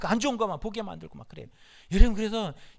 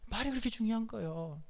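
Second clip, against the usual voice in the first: ordinary talk, nothing marked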